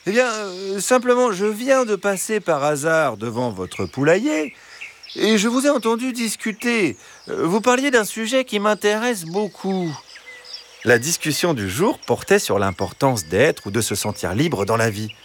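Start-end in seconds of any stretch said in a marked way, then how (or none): 0.1-10.0 high, chatty fox voice; friendly fox. Acted. Fox explaining why he stopped by, high and friendly. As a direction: charm the hens as the fox.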